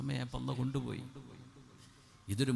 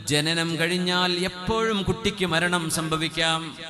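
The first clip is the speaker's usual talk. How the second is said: high, loud, slow impassioned sermon; preaching at full force; 6 semitones higher, 6 dB louder, slower than usual. Acted. Preacher to his congregation on DNA, fiery sermon voice. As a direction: drive the point home to the crowd. Preacher warns death anytime.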